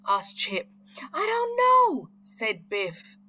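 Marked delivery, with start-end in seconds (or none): 1.1-2.1 frightened. A narrator's reading. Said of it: "The children were frightened".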